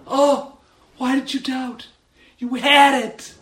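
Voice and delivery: high, loud, encouraging exclamation; excited for him. Acted. Preacher as Jesus excited for Peter walking on water, encouraging. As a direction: cheer him on through the near miss. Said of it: "Jesus Christ was excited for Peter".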